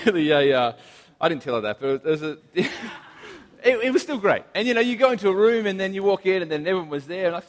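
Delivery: ordinary talk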